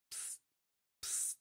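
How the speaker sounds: two short hissed pssts; sharp little hiss, repeated; psst sound. Acted. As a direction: imitate a quick squirt of air. Imitates needle injecting air.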